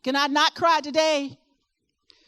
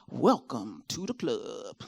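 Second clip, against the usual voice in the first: low, slow Virginia twang on "welcome to the club"; 12 semitones lower, 4 dB quieter, slower than usual. Shown as a demonstration. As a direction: let the Virginia swang drawl out, much lower. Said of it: "That was that Virginia swang there".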